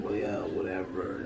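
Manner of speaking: low, slow, soft shrug of a voice; acted indifference. Teacher acting out someone who can't be bothered, indifferent. Acting out a half-hearted attitude.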